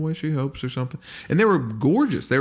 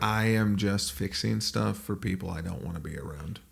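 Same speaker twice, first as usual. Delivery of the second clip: quiet, low-key grumble; grumbling dad; 3 semitones lower, 7 dB quieter than usual. Acted. Dad fixing things for unwanted guests, soft grumbling. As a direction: complain softly to himself as a put-upon dad. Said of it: "your dad would be like".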